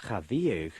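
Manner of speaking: "Cha viag" with viag in two syllables. Shown as a demonstration. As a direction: split "viag" into vee-ag after cha. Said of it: "you say viag with two syllables".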